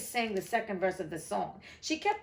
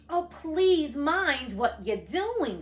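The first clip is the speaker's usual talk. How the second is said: higher, slow frightened cry; terrified plea; 5 semitones higher, 4 dB louder, much slower than usual. A narrator's reading. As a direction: beg them to stop in terror. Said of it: "cried Alice, jumping up and down in an agony of terror".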